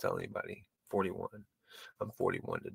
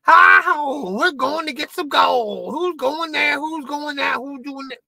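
much higher, cartoonish old prospector; 13 semitones higher, 4 dB louder, slower than usual. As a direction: cackle it like a wild old gold digger. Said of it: "I look like the prospector".

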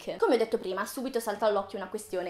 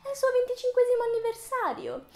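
Disney's mock reply; 10 semitones higher than usual. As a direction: answer as the company in a pleading, high put-on voice. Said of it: "La Disney risponde".

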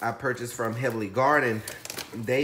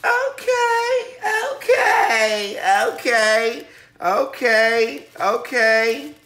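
shrill, very high excited okays; excited shrieking; 10 semitones higher, 10 dB louder, slower than usual. Real, not acted. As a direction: squeal each okay with glee. Excited unboxing, repeated shrieked okays.

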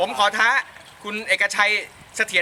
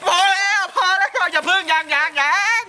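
frantic pleading; 8 semitones higher than usual. Real, not acted. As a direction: beg them to hold off. Begging them to stop pouring.

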